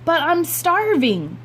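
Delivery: put-on high, slow pleading boy voice; whiny starving kid. Boy whining to his mother at breakfast, slow and high. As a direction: draw out the complaint like a hungry kid.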